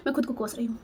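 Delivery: breathy whisper to herself; whispered self-reproach. Real, not acted. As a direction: drop to a whisper, ashamed. Self-blame, dropped to whisper.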